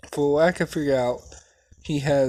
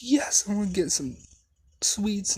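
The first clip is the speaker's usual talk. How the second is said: put-on high, eager child voice; excited kid; 8 semitones higher than usual. Deku cheering to his mom about sweets, eager. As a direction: bounce with excitement over sweets.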